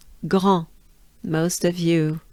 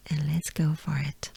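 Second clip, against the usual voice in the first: whisper; 5 semitones lower, 9 dB quieter than usual. Real, not acted. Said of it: "whispered cue".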